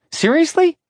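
can't believe it; exasperated disbelief. Acted. Exasperated inner reaction.

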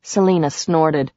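usual speaking voice

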